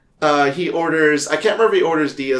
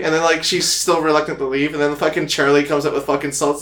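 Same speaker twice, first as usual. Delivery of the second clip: ordinary talk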